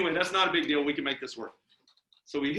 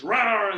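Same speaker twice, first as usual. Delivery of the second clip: short, loud rawr; mock monster roar; 5 semitones higher, 4 dB louder than usual. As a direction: jump out with a playful roar. Acts out jumping from bushes.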